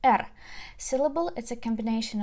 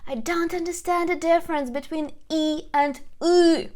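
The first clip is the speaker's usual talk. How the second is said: higher puzzled learner voice; mock student question; 6 semitones higher, 3 dB louder than usual. Teacher voices a puzzled student asking Ina. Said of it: "you can tell me".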